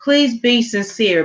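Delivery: normal talking voice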